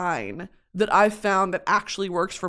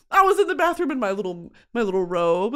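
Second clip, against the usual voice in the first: normal talking voice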